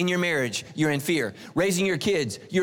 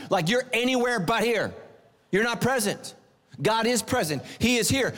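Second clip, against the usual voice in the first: usual speaking voice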